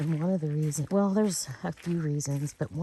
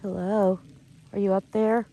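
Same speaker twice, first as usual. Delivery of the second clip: calling out loudly into the woods; loud, raised call; calling from far away; 3 semitones higher, 5 dB louder than usual. Real, not acted.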